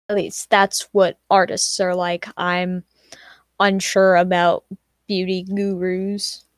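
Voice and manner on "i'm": normal talking voice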